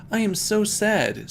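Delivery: high, whiny mock crying; hammed-up teary whine. A narrator's reading. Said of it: "said Amy in a crying way".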